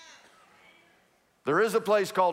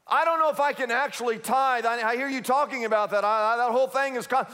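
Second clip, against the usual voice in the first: doubtful believer; 4 semitones higher, faster than usual. Retold or imitated. Doubter fretting to the pastor about tithing, higher and fast. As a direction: stumble over the words like a nervous skeptic.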